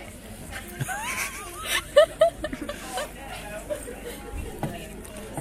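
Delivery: long shrieking fit of laughter; high laughing shriek. Real, not acted. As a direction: burst into high helpless laughter. Can't concentrate, bursts laughing.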